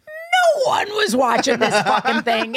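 loud, high comic exasperation; emphatic punchline. Real, not acted. Host admitting nobody watched her show, exasperated shout. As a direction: blurt out the humbling truth.

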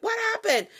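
high, sharp mom asking what happened; a mother confronting her kid; mock mom. Mom questioning her kid, a high mock demand. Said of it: "your mom's like".

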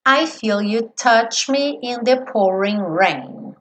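"I feel you touch me in the pouring rain" slowly, touch ending in tch; slow lyric. Shown as a demonstration. Teacher models the next lyric. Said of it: "atenção a essa palavra do touch".

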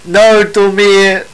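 slow, halting Spanish with an English accent; halting phrasebook tourist. Real, not acted. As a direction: read Spanish slowly like a tourist. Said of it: "my broken Spanish".

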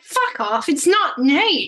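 loud, high, slow exasperated snap; angry outburst. Retold or imitated. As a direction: bark it out, fed up and annoyed. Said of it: "I was like".

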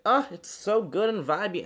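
high, loud, drawn-out gush; delighted gushing. Retold or imitated. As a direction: imitate customers gushing over the tunes. Customers gushing over her music.

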